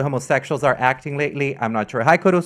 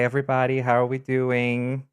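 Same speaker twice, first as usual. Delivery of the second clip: usual speaking voice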